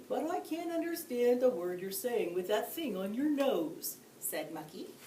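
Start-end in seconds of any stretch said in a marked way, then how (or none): 0.1-4.0 moose character voice. A narrator's reading. Mucky speaks, moose character voice.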